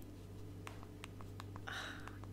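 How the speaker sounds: long breathy sigh. Pretend. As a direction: let out a drawn-out sigh. Sighs at the failing signal.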